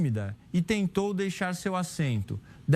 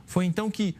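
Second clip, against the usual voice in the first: usual speaking voice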